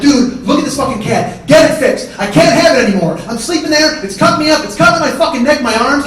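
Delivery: heated, desperate tirade; furious yelling. Acted. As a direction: yell at your brother in exasperation. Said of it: "I'm furious and I'm yelling at Scott".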